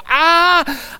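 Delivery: sudden high yelp of pain, imitating wife; a sharp high "Ah!" as if hit in the mouth; startled cry. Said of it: "I hear Margie go".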